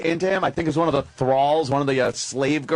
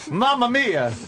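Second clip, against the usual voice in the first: mock Italian; 8 semitones higher, slower than usual. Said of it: "I do a great impression of, uh, of, uh, Mussolini".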